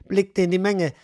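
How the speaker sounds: plain reading voice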